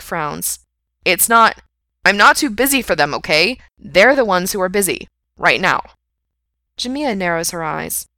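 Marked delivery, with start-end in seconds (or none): 1.0-5.8 loud, insistent self-defence; defensive protest. A narrator's reading. Man defends himself to his friend, defensive. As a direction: push back loudly at the accusation.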